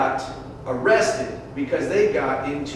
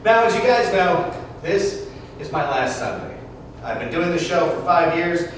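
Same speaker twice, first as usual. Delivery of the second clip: smooth jazz radio DJ voice; radio host; 4 semitones higher, 3 dB louder than usual. Acted. DJ on air to his Sunday listeners, radio host read. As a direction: talk to your listeners like a late-night host.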